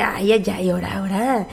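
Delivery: weak sick groan. Real, not acted. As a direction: feeble moan from being sick. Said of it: "ando bien mala de la gripa". (quejido débil de enferma)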